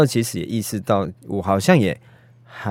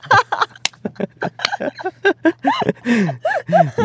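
long laugh. Real, not acted.

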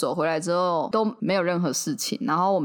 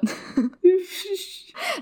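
high whimpering laugh; 12 semitones higher than usual. Real, not acted. Laughing at her knock-on-the-door rule.

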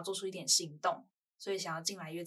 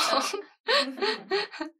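laughing; 13 semitones higher, 9 dB louder than usual. Real, not acted. Host laughs along.

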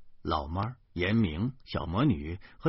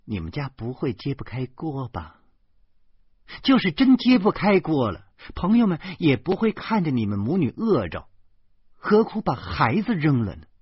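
coldly; 5 semitones higher, 5 dB louder than usual. A narrator's reading. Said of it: "严明冷冷地说".

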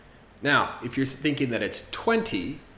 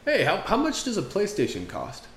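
put-on higher, casual young guy asking the price; guy asking a friend; 9 semitones higher than usual. Guy asking his friend what a PlayStation costs, casual. Two guys talking about PlayStation.

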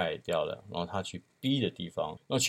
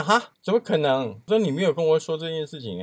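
higher, louder mock indignation, slowed; indignant outburst; 9 semitones higher, 6 dB louder than usual. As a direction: retell the friend's outrage, hammed up. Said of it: "我那朋友真的是快要冒火了".

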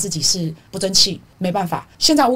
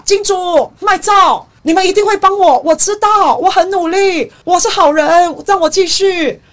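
much higher, louder mock tearful begging; Zelensky crying to donors; 9 semitones higher, 7 dB louder than usual. Commentator mocking Zelensky crying to his backers, hammed-up tearful plea. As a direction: whimper and beg the money men not to stop. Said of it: "就哭嘛".

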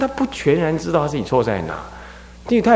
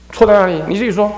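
raised, demanding question; questioning monk; 8 semitones higher, 4 dB louder, faster than usual. Acted. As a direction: demand that the child explain. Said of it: "然后和尚说".

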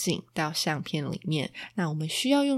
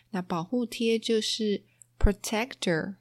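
ordinary delivery

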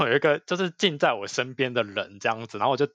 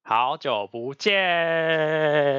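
lazy drawn-out hello; slow drawn-out; 5 semitones higher, 4 dB louder, much slower than usual. Pretend. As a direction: stretch each syllable very slowly. Drawn-out playful greeting.

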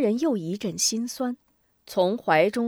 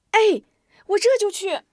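put-on high, loud child reply; eager boy; 13 semitones higher, 8 dB louder than usual. A narrator's reading. Boy answering the teacher, eager. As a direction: shout it as you run off.

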